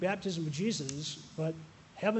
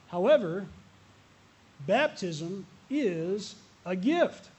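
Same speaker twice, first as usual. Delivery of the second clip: very slow, louder "baptism is a gift"; emphatic turn; 5 dB louder, slower than usual. Real, not acted. Pastor turning from the joke to the point, very slow and loud. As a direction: spell it out word by word.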